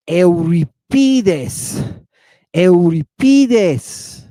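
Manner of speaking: Euripides very slowly as "eh-oo-ri-PEE-thes"; ancient Greek pronunciation. Shown as a demonstration. Teacher showing how Greeks sang it, Euripides stretched with long "PEE". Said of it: "how do they pronounce it? Not like we are".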